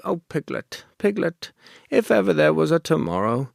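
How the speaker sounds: attempted Pooh voice. Shown as a demonstration. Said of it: "I'm just getting into the voice".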